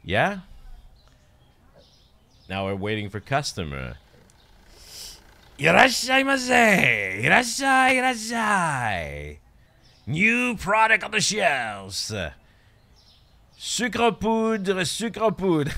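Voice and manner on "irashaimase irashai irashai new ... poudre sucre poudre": high, loud shop greeter's call with drawn-out words; Japanese store yell. Acted. Shopkeeper imitating how Japanese stores yell to customers, high sing-out yell. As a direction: holler like a hawker touting new products. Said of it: "They go and they go yell".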